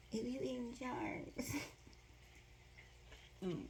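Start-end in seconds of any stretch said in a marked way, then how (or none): none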